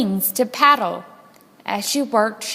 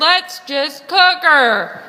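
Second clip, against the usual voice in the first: impatient pirate; 5 semitones higher, 6 dB louder, slower than usual. Acted. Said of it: "shouted a third".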